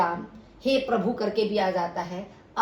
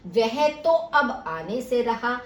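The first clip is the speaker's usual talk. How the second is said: slow, वह तो stressed; 2 semitones higher, 3 dB louder, slower than usual. Shown as a demonstration. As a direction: lean on वह. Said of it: "वह पर विशेष बल". (वह पर ज़ोर देकर)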